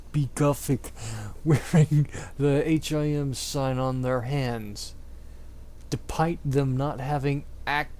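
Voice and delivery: ordinary talk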